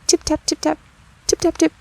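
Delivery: high, light tapping sounds; mouse tapping. Acted. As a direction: chirp the taps like tiny feet. Mice tapping under teacup.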